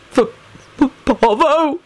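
halting, breaking high and slow; stammer, then crack upward on the name. A narrator's reading. Baldy vows revenge for his friend, voice breaking. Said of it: "His voice broke on the word".

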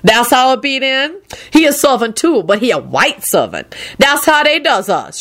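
high, sassy complaining character voice; threatening servant. A narrator's reading. Servant complains to no one in particular, threatening. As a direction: grumble with high, swooping indignation. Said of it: "in a threatening manner".

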